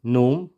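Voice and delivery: नोम as one syllable, no g; correct, silent g. Shown as a demonstration. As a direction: say नोम, starting on n and ending on m.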